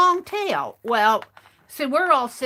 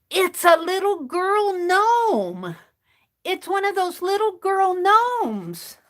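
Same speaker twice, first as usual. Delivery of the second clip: amazed discovery; 8 semitones higher, 4 dB louder, slower than usual. Retold or imitated. Discovering the gnome on Bandit.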